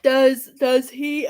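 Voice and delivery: slow words through a yawn. Real, not acted. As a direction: talk mid-yawn. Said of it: "I don't know why I'm yawning".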